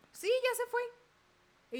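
put-on high, slow little girl voice; slow, high childlike reply; child answering. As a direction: drag it out high like a small child.

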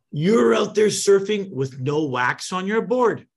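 high, loud, drawn-out mock disbelief; incredulous taunt. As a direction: imitate a kid calling out a clueless beginner, slowly. Acted kid's incredulous taunt.

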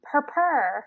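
put-on "purr" said as a toddler's version of potion; toddler saying potion. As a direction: copy the kid's cute "purr" for potion. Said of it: "he goes".

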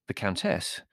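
usual speaking voice

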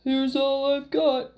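slow, loud giant; big, ponderous giant's voice; booming great voice. A narrator's reading. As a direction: boom it out slowly. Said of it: "the great voice boomed".

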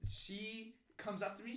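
ordinary talk, nothing marked

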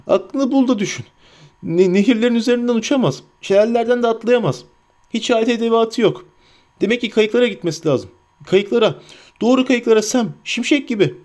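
high-pitched, urgent hobbit self-talk; Sam. A narrator's reading. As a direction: reason it out high, then spur yourself on. (tiz, telaşlı hobbit sesiyle kendine konuşma)

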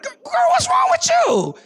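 high, slow, loud mock scolding; hammed-up disbelief at the sister; mock exasperated shout. Pastor retells scolding the sister.

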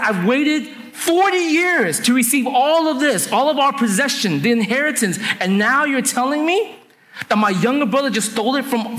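high-pitched, indignant outburst; wronged brother, incredulous and angry; furious. Acted. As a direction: protest a betrayal in outraged disbelief. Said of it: "Esau was furious".

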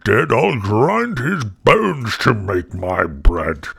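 loud, booming ogre chant; thunderous storybook giant; giant's roar. A narrator's reading. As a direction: roar it out slowly at the end, like a menacing giant. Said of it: "he roared out".

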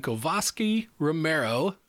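ordinary talk

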